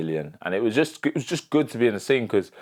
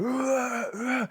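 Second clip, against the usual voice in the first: hyped-up roar. Retold or imitated. Boxer roaring to hype himself before sparring, wild yell. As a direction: roar like a fighter psyching up. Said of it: "Alan's going".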